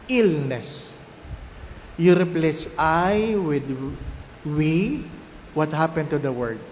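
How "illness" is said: normal talking voice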